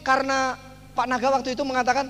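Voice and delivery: ordinary talk, nothing marked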